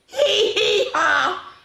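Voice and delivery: very high, slow braying hee-haw laugh; hammed-up donkey laugh. Shown as a demonstration. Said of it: "this time you go".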